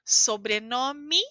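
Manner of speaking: slow "Sobrenome" ending in i; final E as i. Shown as a demonstration. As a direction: stretch out "Sobrenome" with an i at the end. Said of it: "a gente fala i".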